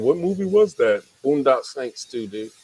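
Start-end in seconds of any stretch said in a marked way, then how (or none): 0.0-1.0 high, puzzled viewer voice; confused viewer voice. Retold or imitated. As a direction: ask it like a puzzled fan. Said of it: "somebody right now is going".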